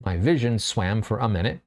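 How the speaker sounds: usual speaking voice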